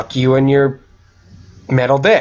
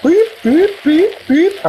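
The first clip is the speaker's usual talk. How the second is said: very high little vehicle beeps; imitated beeping; 14 semitones higher than usual. Player riding a Segway mimics its warning, imitated beeping. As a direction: squeak out evenly spaced beeps.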